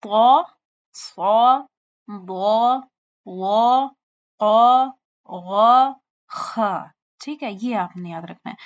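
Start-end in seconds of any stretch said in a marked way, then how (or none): 0.0-6.8 طا, صا, ضا, قا said heavy and louder; the seven heavy letters, said thick. Shown as a demonstration. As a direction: read each letter thick and full. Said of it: "صرف یہ موٹے پڑھے جاتے ہیں".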